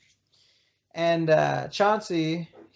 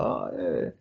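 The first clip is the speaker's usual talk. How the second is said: much lower, unvoiced and hushed; whispered awe; 13 semitones lower, 5 dB quieter than usual. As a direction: breathe it out in awe. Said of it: "Everybody was like".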